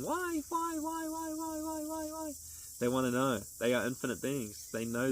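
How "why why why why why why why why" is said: put-on high, small child voice repeating why over and over; mock toddler voice, pitched way up; toddler asking why. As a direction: imitate a pestering toddler. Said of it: "you always hear toddlers saying".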